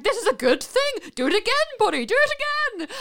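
high, loud and giddy; excited. Retold or imitated. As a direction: cheer it on like great news. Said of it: "I was like".

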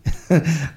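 high, loud little laugh; a quick laugh mid-line; short laugh. Real, not acted. Laughing at own reading.

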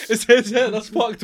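loud, high, cracking up; laughing outburst. Real, not acted. Friend mocking another's messy hair, laughing outburst. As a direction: blurt it out through laughter.

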